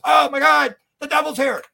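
high, loud hammed-up panic over the devil; mock terror. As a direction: act like you just saw the devil. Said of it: "And then the guy goes".

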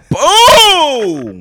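huge, high, drawn-out boom; triumphant shout. Pretend. Host mocking co-host's mic drop, big drawn-out shout.

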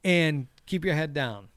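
imitated lecture to a beginner; coaching boyfriend. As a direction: lay down the rules like an amateur coach. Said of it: "he is teaching her".

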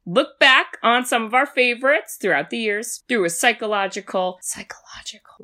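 breathy whispered intro line. Acted. Podcast host whispering the intro tagline. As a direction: drop to a whisper.

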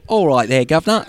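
hammed-up Cockney accent, pitched high on Governor; a jokey Cockney hello to the host; put-on London Cockney voice; mock cockney greeting. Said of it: "are you suggesting that your accent is not strong enough".

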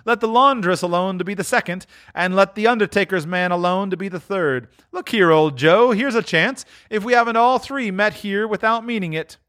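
put-on high, gleeful old woman voice; cackling thief's glee; scheming charwoman. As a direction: needle the others, pleased with herself.

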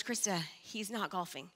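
low, soft, solemn telling; hushed grave news. Retold or imitated. Friend on the phone telling Krista of her husband, soft and grave. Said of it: "And she goes".

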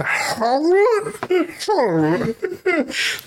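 high-pitched, drawn-out mock gulping howl; exaggerated cartoon swallow with a laugh; imitated gobbling noise. Teller imitating corn swallowed whole, high gulping noise.

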